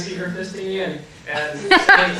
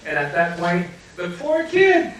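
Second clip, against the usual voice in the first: ordinary delivery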